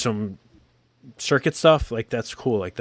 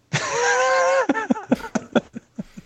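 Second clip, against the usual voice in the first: burst of laughter. Real, not acted. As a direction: crack up at the cheeky sign-off. Reacts to cheeky letter PS.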